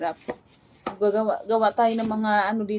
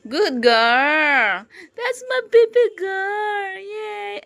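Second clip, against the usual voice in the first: praising sing-song; 9 semitones higher, 7 dB louder, much slower than usual. Real, not acted. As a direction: coo the praise up high. Praising toddler after obeying.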